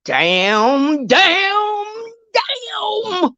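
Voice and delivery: rising into a high, crying Damn, damn, damn; Florida Evans wail. Retold or imitated. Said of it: "in my Florida Evans voice".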